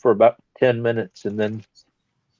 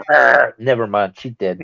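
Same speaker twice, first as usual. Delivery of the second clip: ordinary talk, nothing marked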